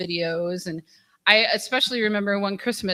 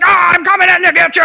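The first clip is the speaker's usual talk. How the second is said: higher, louder, fast mock-menacing man's voice; straight man voice; 10 semitones higher, 14 dB louder, faster than usual. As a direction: put on a fake tough guy to scare her. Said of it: "does some, like, straight man voice".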